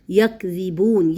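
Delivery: very slow یکذبون, ya held onto kaf; drawn-out careful recitation; tajweed recitation. Shown as a demonstration. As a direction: say یکذبون slowly, binding یک together without a jolt. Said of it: "یا کو کاف کے ساتھ پکڑنا ہے،".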